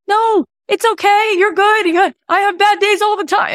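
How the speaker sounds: high, soothing imitated friend; calm him down gently, playfully voiced; mock reassuring. Friend comforting an upset driver, high reassuring voice.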